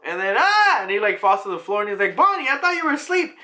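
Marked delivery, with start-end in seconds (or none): 0.4-0.8 high, loud mock "ah!"; sudden comic cry of fright; startled scream. Startled dad falls to floor. 2.2-3.4 high, flustered dad; pitched-up mock alarm; startled.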